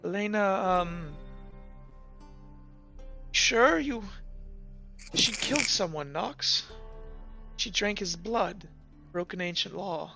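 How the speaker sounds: small, halting voice, holding back tears; choked up. Acted. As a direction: break the bad news softly, haltingly. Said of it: "gets caught up in her throat".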